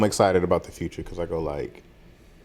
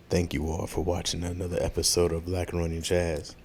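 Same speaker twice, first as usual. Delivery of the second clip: low, soft R&B sign-off; sultry, hushed host voice; nice and sexy; 5 semitones lower, 8 dB quieter than usual. Acted. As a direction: croon the thanks smoothly and quietly. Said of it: "Do it, do it nice and sexy".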